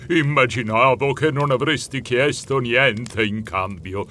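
usual speaking voice

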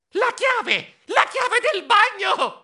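very high, insistent shout; desperate yell. Acted. Mouse to deaf uncle, desperate high yelling. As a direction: stretch it out for deaf ears. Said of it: "gli urlai nell'orecchio disperato".

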